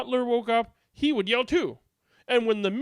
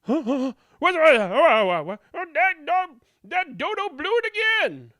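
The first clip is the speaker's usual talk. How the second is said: startled villager; 9 semitones higher, 7 dB louder than usual. Acted.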